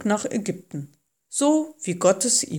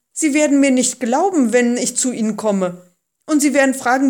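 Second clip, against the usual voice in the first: raised, worried protest; objecting; 5 semitones higher, 6 dB louder, faster than usual. A narrator's reading. Moses fearing Israelites will not believe him, objecting. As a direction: argue back, higher and louder. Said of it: "wandte Mose ein".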